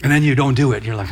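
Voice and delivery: normal talking voice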